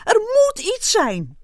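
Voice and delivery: high, loud angry yell; furious. A narrator's reading. Ant stamping and pacing, furious shout. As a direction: rage that it must be so. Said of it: "liep woedend heen en weer".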